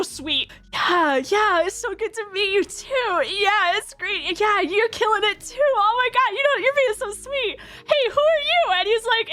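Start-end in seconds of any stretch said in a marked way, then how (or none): 0.7-8.7 tipsy gushing. Retold or imitated.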